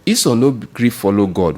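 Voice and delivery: ordinary talk, nothing marked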